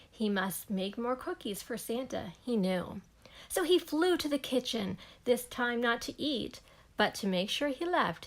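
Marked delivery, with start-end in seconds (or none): none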